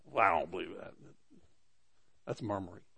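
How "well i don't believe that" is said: low, grumbling doubter's voice; low disgruntled grumble; grumbling murmur. Retold or imitated. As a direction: mutter it like a sulky doubter. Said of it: "this means".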